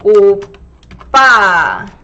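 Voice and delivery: 오빠 drawn out slowly with a tense pp; slow teacher model of 오빠; slow model word. Shown as a demonstration. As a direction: stretch 오빠 out, tight lips on the pp. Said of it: "I'll type in here".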